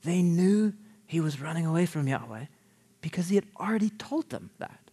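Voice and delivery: softly, as if whispering in our ear; confiding aside. Retold or imitated. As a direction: lean in and confide the secret. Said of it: "the storyteller whispers in our ears here".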